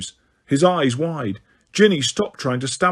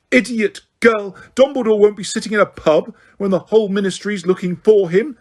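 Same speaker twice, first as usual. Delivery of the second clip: put-on scorn for a stupid girl; angry shout; 6 semitones higher than usual. A narrator's reading. Umbridge to Hermione, shouting in disappointed anger. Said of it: "shouted Umbridge".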